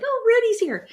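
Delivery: high, thrilled little gasp; delighted he's back; excited gasp. Retold or imitated.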